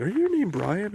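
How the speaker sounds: high-pitched mimicked question; imitating girl passerby. Retelling what the two girls asked him, high imitated question. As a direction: lift the voice high to mimic the asker. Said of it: "They said".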